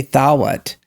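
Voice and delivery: "thawat" in two syllables, AH gliding into WUT; Southern diphthong. Shown as a demonstration. Showing how Southerners say thought, two-syllable "thawat". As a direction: draw "thawat" out with a w glide. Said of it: "but they say".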